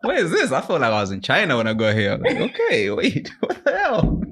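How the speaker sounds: high, laughing disbelief; a student arriving in the wrong city; laughing mock confusion. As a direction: act lost through laughter.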